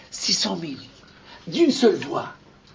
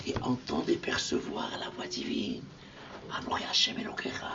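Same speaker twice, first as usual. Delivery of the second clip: hushed whisper; 6 semitones lower, 13 dB quieter than usual. Acted. Describes the silence at Sinai.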